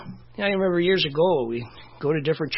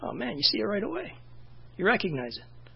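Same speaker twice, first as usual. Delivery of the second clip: usual speaking voice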